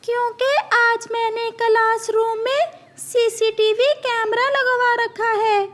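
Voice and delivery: high, bright-pitched teacher bird voice; a high squawky parrot teacher calling on a student. Acted.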